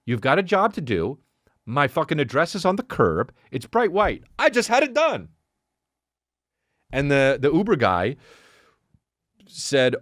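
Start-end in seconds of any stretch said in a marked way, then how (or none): 0.0-5.3 indignant outburst climbing higher and higher; retold irate complaint, rising steeply; hot-headed rant. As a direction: work yourself up to an exasperated high-pitched finish. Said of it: "Your boy gets super hot".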